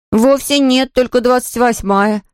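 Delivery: put-on high-pitched child's retort; boy objecting. A narrator's reading. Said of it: "ответил Ваня". (мальчик возражает высоким голосом)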